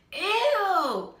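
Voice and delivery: high, loud squeal of disgust. Retold or imitated. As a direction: recoil from the wet sneeze. Coworker sneezing on keyboard.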